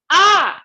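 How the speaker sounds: loud, high acted roar; lion's roar. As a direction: let out a big "Ah!" like a lion. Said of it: "he let out a roar".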